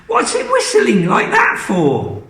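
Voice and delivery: very high, puzzled fret; worried Missus Cranky. Acted. Missus Cranky doubting the hen's whistle, high fretful voice. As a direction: fret over the odd whistle.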